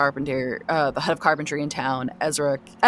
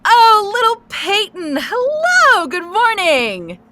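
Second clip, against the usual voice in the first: high, loud, slow sing-song hello; gushing mayor greeting; 10 dB louder, slower than usual. Acted. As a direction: coo down from the roof at the kid like a delighted mayor. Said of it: "she looks down and says".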